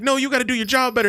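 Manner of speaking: high fast scolding; mock server. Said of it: "And she's like".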